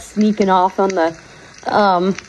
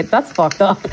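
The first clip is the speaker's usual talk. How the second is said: laughing; 10 semitones higher than usual. Real, not acted. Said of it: "I'm about to say".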